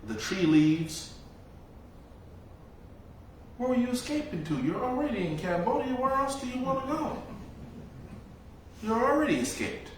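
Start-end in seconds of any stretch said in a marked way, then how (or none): 3.6-9.9 much higher, gently teasing elder's voice; elder teasing the runaway young monk, high-voiced; imitating the elder. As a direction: ask it like a wise old monk amused at a student.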